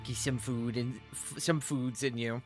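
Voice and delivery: normal talking voice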